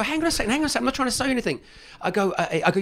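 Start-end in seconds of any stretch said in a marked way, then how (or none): 0.0-1.6 high, quick "hang on a second", stopping him hanging up; urgent protest. Retold or imitated. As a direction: jump way up in pitch to stop the call.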